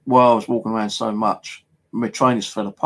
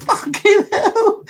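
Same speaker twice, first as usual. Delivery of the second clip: much higher, slow, laughing mock fright; a man on the bench spooks a passing stranger, mock high shriek; startled passer-by; 4 dB louder, much slower than usual. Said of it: "He goes".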